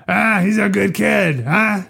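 high-pitched hammed-up tough cop; pointed mock challenge. Cop saying it to Sollozzo instead, mock challenging. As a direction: push it up high and end on a questioning huh. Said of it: "different if he had said to Sollozzo".

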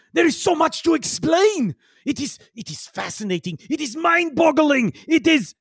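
put-on high-pitched, fervent accented outburst; excited Yigsel. Yigsel raving about his discovery, excited outburst. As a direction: gush in a high character voice.

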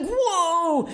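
mock-panicked high cry; panicked whoa. Seatmate freaking out during landing, startled yelp. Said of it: "He was like".

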